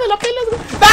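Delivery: high laughing taunt; mock the opponent, laughing; laughing shout. Real, not acted.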